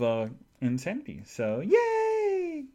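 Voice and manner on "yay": very high, drawn-out cheer; cheery yay. Real, not acted. Celebrating the chores ahead, high drawn-out cheer. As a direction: squeal a little long cheer.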